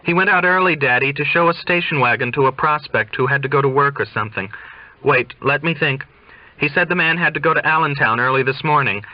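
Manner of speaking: quick, high, chatty sales talk; salesy patter. Acted. Daughter inventing an excuse for her father, quick high patter. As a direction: rattle off the story like a car salesman. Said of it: "Car sale talk is one thing she knows".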